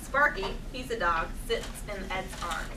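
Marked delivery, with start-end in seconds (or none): none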